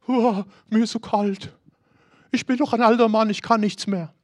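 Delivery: put-on whiny old man. Voicing old David's self-pity.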